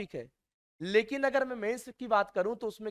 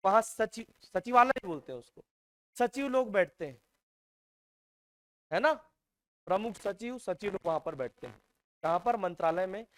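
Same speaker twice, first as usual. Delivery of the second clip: usual speaking voice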